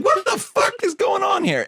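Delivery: high-pitched exasperated cry; incredulous outburst. Real, not acted. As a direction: voice shooting way up in disbelief. Exasperated outburst about the film.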